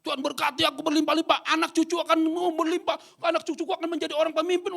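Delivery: very high, loud self-serving prayer; loud greedy prayer. Retold or imitated. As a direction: ham up the selfish believer shouting for blessings. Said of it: "ngomongnya tinggi banget". (meniru doa egois, sangat tinggi dan keras)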